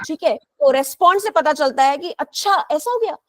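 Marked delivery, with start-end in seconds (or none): 2.1-3.2 one way of saying achchha; reacting "achchha". Shown as a demonstration. Teacher showing students how achchha changes with tone, reacting. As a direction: respond with an "oh, is that so" achchha. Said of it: "एक ही word को आप अलग-अलग तरीके से बोल रहे हैं".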